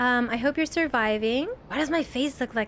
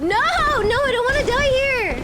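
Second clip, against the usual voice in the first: high, loud, desperate screams; panicked scream; 11 semitones higher, 6 dB louder than usual. Real, not acted. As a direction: scream like the hit is coming now. About to die in the game.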